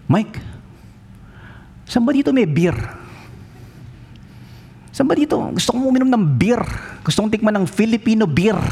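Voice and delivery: a hint of a German friend begging for beer; German pastor asking.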